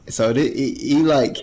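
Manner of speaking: usual speaking voice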